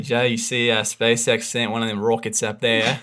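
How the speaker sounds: put-on broad Aussie accent, chatty; Australian accent. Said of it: "such a brilliant Australian accent".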